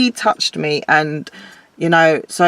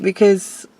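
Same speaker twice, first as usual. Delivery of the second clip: ordinary talk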